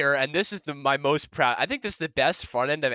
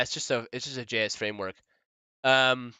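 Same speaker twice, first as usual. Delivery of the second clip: ordinary delivery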